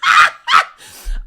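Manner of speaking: high, loud shrieking laugh; squealing laughter. Real, not acted. Laugh after confession.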